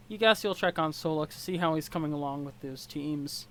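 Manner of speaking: ordinary delivery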